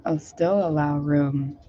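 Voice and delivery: ordinary talk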